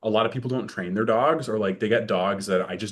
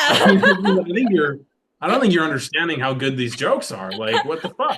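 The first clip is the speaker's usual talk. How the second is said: laughing; 8 semitones higher, 7 dB louder than usual. Real, not acted. Laughing while defending his jokes.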